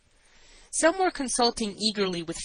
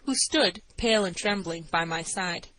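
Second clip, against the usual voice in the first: ordinary delivery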